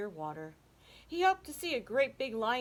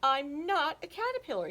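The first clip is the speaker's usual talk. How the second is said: Hugo insisting; 8 semitones higher, 5 dB louder than usual. Acted. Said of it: "Hugo said".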